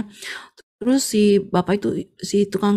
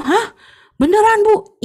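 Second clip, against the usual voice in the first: much higher, louder stunned question; shocked gasp; 12 semitones higher, 7 dB louder, slower than usual. Acted. Tofu seller hearing his minibus crashed, stunned. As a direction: react in sudden shock.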